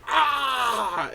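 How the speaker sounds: high mock battle cry; dwarf rage roar. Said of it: "smack his chest and just go".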